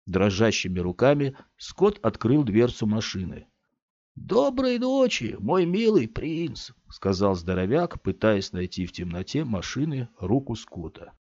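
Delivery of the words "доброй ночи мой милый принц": much higher, slow crooning; tender farewell. A narrator's reading. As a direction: linger over goodbye. Big man farewell.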